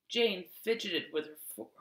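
plain reading voice

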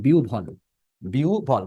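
"বিউ ভল" as biu-bhol, with aspirated bh; correct pronunciation. Shown as a demonstration. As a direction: model "বিউ ভল", gliding i into u. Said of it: "তাহলে হটা হয়ে যাবে রস্যাও".

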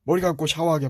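normal talking voice

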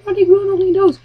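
put-on high worried kid voice; a small child pleading not to die; scared child. Child replies to threat.

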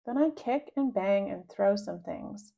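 plain reading voice

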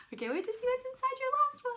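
eager chatter before the last oyster. Real, not acted. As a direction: race through it with excitement. Anticipating the last pearl.

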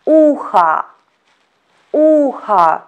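uha with the first O said as a; Russian uha. Shown as a demonstration. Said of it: "we pronounce it as letter A".

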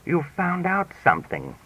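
ordinary delivery, nothing marked

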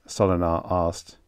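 ordinary delivery, nothing marked

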